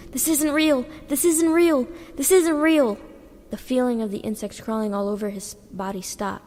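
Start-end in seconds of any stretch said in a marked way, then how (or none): 0.1-3.0 high, loud desperate yell. A narrator's reading. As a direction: shout it over and over with eyes shut, higher and louder. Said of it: "starts to yell".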